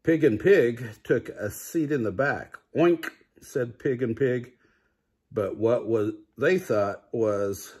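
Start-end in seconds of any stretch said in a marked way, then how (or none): 2.8-3.1 higher oink. A narrator's reading. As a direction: squeal out a pig oink. Pig noise in story.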